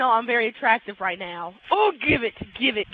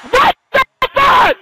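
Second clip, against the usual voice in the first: high screamed disbelief; real outraged screech; furious shout; 13 semitones higher, 9 dB louder, slower than usual. Real, not acted. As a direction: scream it, stretching the words. Angry reaction to game.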